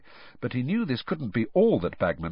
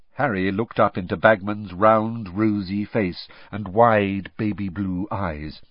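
ordinary delivery

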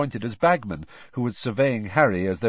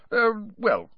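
nervous; 13 semitones higher than usual. A narrator's reading. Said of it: "looking suddenly nervous".

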